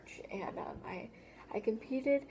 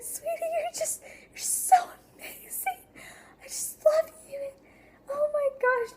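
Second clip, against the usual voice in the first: very high, loud squeaky mock-weepy mom voice; crying mother; 10 dB louder than usual. Mother tearfully praising her singing child, mock crying in a very high voice. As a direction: imitate a mother gushing through happy tears, pitched way up. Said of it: "She is crying".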